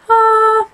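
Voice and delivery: sudden high, loud falsetto ah; head voice ah. Shown as a demonstration. Teacher demonstrating a head voice ah to yodel students. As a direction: flip up into head voice on ah. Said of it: "lowercase are in your head voice".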